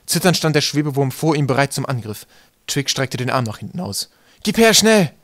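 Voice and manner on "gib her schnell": high, loud, frantic demand; urgent shout. A narrator's reading. Twig demands the knife from his friend, shouting urgently.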